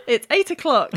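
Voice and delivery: chirpy clock announcement; talking alarm clock. Acted. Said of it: "It's a talking clock".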